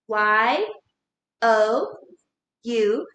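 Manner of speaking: letter by letter, a pause after each; spelling out. Shown as a demonstration. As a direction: spell out Y, O, U separately. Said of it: "spell the word".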